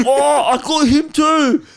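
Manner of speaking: loud mock braying of boys roughhousing at a kebab shop; rowdy private school lads. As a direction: crow like a boisterous schoolboy landing a hit. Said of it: "he's just like".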